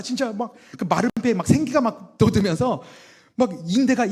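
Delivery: high, loud, rushing with wonder; excited telling. Pretend. Preacher picturing his healing, high excited. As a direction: pour it out like you can see the miracle.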